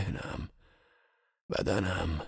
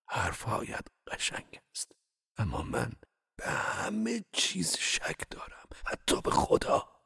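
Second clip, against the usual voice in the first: with difficulty; 7 semitones higher than usual. Acted. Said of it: "به سختی گفتم".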